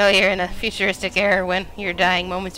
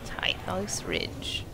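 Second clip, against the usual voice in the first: slow, softer whisper; 6 dB quieter, much slower than usual. Pretend. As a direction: breathe the name slowly. Reads a place name while exploring.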